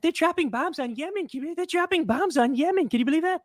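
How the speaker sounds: light mocking impression of Trump. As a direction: parody a shocked president.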